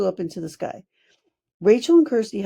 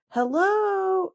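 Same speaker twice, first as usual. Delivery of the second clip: high, drawn-out call up the tree; a friendly hedgehog hollering hello; 13 semitones higher than usual. A narrator's reading. Hedgehog calls up to the fairies in the tree, hollering. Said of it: "he hollered again".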